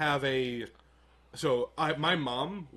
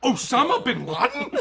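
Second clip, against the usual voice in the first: much higher and louder, laughing; shocked disbelief; 10 semitones higher, 8 dB louder than usual. Retold or imitated. Teen hears mom say Obama was killed, shocked. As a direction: exclaim in stunned disbelief. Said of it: "And I'm like".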